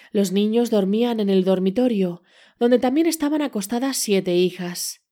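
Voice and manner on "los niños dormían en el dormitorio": usual speaking voice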